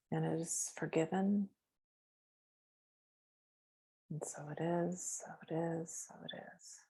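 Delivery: hushed healing visualization; guided meditation. Acted. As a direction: guide them gently into the light. Closing the meditation.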